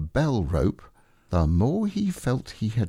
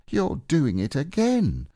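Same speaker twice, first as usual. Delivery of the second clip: usual speaking voice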